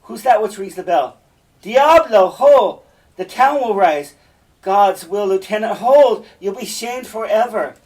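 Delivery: high, loud stage shouting; urgent, exclamatory hollering; raising the alarm. Acted. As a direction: cry out to rouse the town and stop a brawl. Iago raising alarm as bell rings.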